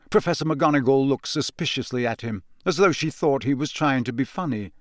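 usual speaking voice

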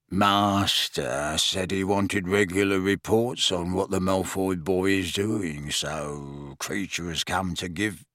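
put-on grudging old elf croaking his report; croaking house elf. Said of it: "croaked Creature".

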